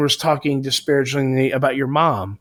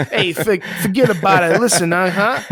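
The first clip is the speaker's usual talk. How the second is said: hammed-up Italian accent, pitched higher; 5 semitones higher than usual. Joking after being asked about the accent, mock Italian accent. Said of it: "Italian accent right there?".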